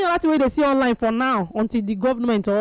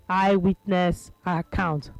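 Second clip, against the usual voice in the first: segment announcer; 3 semitones lower, much slower than usual. Acted.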